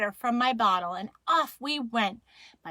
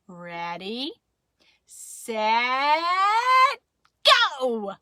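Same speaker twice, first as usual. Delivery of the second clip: drawn-out countdown building to a loud go; starting yell; 3 semitones higher, 6 dB louder, much slower than usual. Acted. Parents start the children's race, building yell. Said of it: "I heard Mama and Daddy yell".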